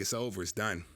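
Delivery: mild heartbroken sigh of defeat; crushed, defeated. Retold or imitated. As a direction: let it sink, resigned and beaten. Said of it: "I'm crushed".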